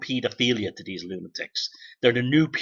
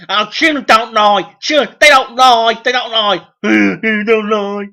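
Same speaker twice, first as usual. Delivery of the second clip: high loud mocking sing-song; mocking whiny voice; 7 semitones higher, 8 dB louder than usual. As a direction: parody gullible believers, whiny and loud. Mocking credulous people's claim.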